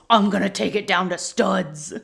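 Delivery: hammed-up growling threat; mock angry growl. Sam defying Dean's plea to stop, mock growl. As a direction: growl it with exaggerated menace.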